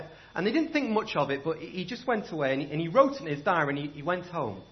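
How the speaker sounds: ordinary delivery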